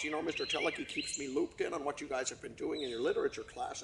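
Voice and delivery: ordinary delivery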